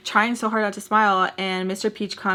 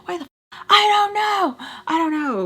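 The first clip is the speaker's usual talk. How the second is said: much higher and louder, as if yelling at herself for a bad choice; mock self-scolding scream; 8 semitones higher, 4 dB louder, faster than usual. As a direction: play the viewer screaming at you, higher and louder in mock panic. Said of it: "You're probably screaming at yourself".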